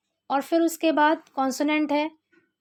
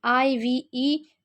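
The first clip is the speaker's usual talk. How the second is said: letters spelled out one by one, slowly; much slower than usual. Shown as a demonstration. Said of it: "क्या आया है".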